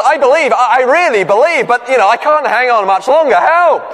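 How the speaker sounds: beg, out of strength, rising to a yell; gasping plea. Retold or imitated. Man hanging from a branch begs the Lord, high and desperate. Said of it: "the man gasped".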